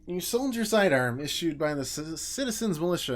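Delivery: loud, pointed interrogation; loud accusing. Acted. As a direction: lay out the charge forcefully. Detective confronting shopkeeper over sidearm.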